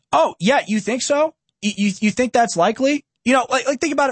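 high, mocking "oh yeah, you think so"; incredulous sarcasm, quick on the tail; sarcastic disbelief. Real, not acted. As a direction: scoff at the claim, pitch up. Mocking the CNN story.

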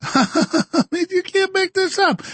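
can't believe it, laugh it out. Real, not acted. Host laughing at absurd news, high laughing disbelief.

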